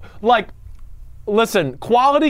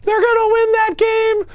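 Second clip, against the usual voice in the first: a much higher, slow, overblown fan voice gushing over Tom Brady; mock hype voice; slower than usual. Mocking the Patriots' fans in a much higher, slow voice. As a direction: ham up the worship of Brady and Belichick, high and drawn out.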